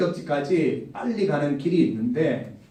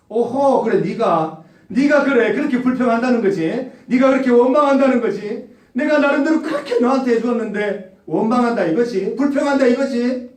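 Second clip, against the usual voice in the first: higher and louder indignant God; offended God; 6 semitones higher, 4 dB louder than usual. Pretend. God rebuking grumbling people, higher and louder indignation. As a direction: play God taking note, affronted.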